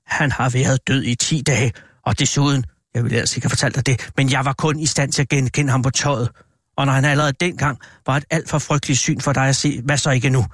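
usual speaking voice

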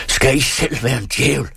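high, strained defiant retort; hissed between teeth. A narrator's reading. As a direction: repeat it defiantly through clenched teeth. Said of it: "hviskede Anders mellem tænderne".

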